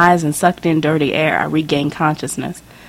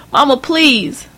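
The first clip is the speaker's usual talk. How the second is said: begging; 9 semitones higher, slower than usual. Acted. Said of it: "I begged, crying like a little girl".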